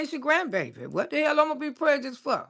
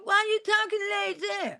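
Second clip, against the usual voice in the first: imitating bullies in a higher mocking voice; teasing bully; 7 semitones higher than usual. Bullies to a proper-talking child, high teasing. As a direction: put on a high, taunting kid's voice.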